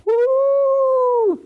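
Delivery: long, very high held woo; imitated flying woo. Retelling the Doctor's flight through space, high imitated woo.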